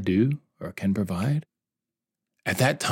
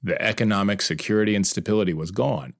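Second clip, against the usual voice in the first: ordinary delivery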